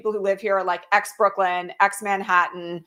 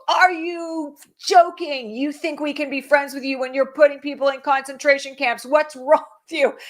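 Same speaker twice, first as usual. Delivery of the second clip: higher-pitched, indignant re-enacted yell; retold enraged shouting; 5 semitones higher than usual. Parade watcher in enraged state at float, re-enacted indignant shout. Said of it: "was, like, shouting at these people".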